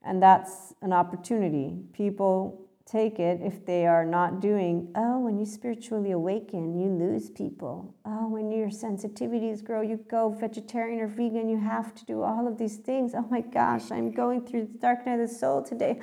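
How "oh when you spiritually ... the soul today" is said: high-pitched, put-on spiritual gushing; hammed-up awakening drama in a raised voice; mock spiritual seeker. Mimicking people caught up in spiritual awakening, mock spiritual seeker. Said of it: "when people are in this mode".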